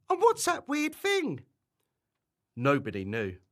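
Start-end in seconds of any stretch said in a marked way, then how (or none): 0.1-1.5 very high-pitched puzzled question; tiny storybook voice, pitched right up; small character's voice. Acted. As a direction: squeak it like a worried little friend.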